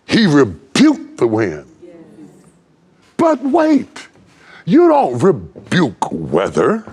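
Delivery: full-throated sermon crescendo; preaching at full force. Acted. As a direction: ride the melody up and let it ring. Preacher whooping at climax.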